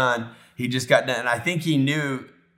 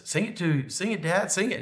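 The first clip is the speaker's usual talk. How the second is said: higher, light urging between sung phrases; coaxing; 7 semitones higher, 3 dB quieter than usual. Retold or imitated. Son urging dad to join the hymn, coaxing. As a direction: coax someone to join in, voice lifted.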